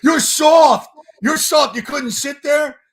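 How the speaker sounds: loud, high jeering taunt; mocking troll. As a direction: yell it like a sneering internet troll.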